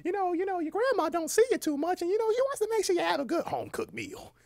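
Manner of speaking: very high-pitched, coaxing grandma voice; grandmother. Acted. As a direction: play a doting grandma in a high put-on voice.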